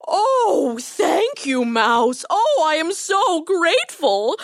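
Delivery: high, gushing relief; grateful lion. A narrator's reading. Lion freed of the thorn, gushingly grateful. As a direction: gush thanks, stretching the words.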